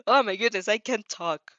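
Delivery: usual speaking voice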